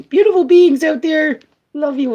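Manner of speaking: loud, high, gushing shout; big high-pitched cheer; exuberant call-out. Real, not acted. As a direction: holler affection out to your audience. Calling out to her audience.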